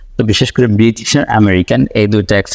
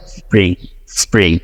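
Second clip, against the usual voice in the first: American accent; 3 semitones lower than usual. Shown as a demonstration. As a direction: do স্প্রিং like a native speaker, no extra vowel. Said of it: "কিন্তু ওরা কি বলে?".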